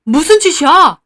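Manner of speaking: high, loud shocked yell; shocked shout. Acted. Father yells in shock at the overturned plate, loud. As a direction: shout out in alarm. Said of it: "소리 질렀어요".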